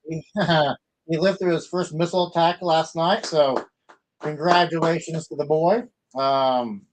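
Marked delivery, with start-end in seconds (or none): none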